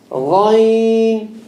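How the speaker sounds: Ghain. gargled from the top of the throat, drawn out; rolled, gargled Ghain; Arabic letter ghain. Shown as a demonstration. Teacher modelling the heavy throat letter, gargled. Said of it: "there's no click".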